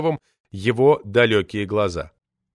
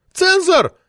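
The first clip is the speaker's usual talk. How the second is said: "Цензор!" shrieked very high and loud; indignant lady screaming "Цензор!"; 7 dB louder than usual. Acted. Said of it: "взвизгнула Метелина".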